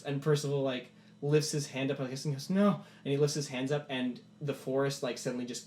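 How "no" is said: loud, high cry of refusal; alarmed cry. Acted. Percival's hand lifts against his will, alarmed cry. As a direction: shout it in alarm.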